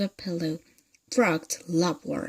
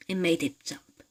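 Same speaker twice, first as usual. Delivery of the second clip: ordinary delivery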